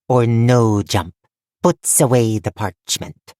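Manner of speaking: slow deliberate goblin voice; goblin insisting. A narrator's reading. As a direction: drag it out, no nonsense. Goblin repeats her command.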